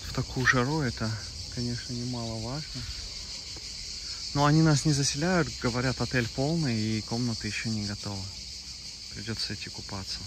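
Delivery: ordinary delivery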